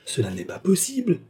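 high-pitched cry of disbelief; distressed exclamation. A narrator's reading. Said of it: "s'écria le malheureux ouvrier". (exclamation incrédule et malheureuse, aiguë)

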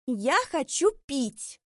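high, loud, slow affected plea; affected whining. Acted. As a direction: drag it out with a pampered demand. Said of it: "с жеманными интонациями".

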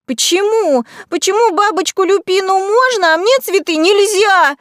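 put-on high, loud childish howl; wailing protest. A narrator's reading. Magnolia, dragged from the pen, kicks and howls in protest. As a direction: wail the unfair question at full pitch. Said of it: "Тут же белугой взвыла Магнолия".

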